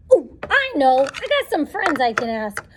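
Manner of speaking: usual speaking voice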